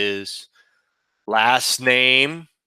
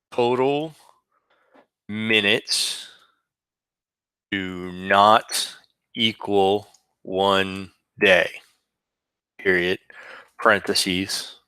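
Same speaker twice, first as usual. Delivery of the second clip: dictating; 2 semitones lower, slower than usual. Shown as a demonstration. Teacher dictating a formula slowly.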